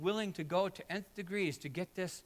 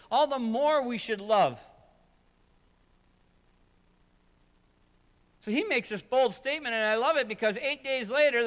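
louder, rising sermon delivery, driving each line home; full-force preaching; 8 semitones higher, 7 dB louder than usual. Acted. Pastor urging hurting people toward hope, preaching hard. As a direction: preach it out to the whole room.

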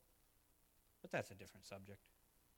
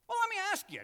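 much higher, louder rhetorical jab; sudden loud challenge; 8 dB louder, much faster than usual. Real, not acted. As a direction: challenge the congregation with a burst.

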